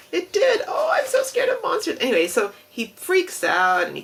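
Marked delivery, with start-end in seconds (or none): 0.1-2.0 very high, louder squeaky fright; Grover, scared. Retold or imitated. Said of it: "You know, Grover style".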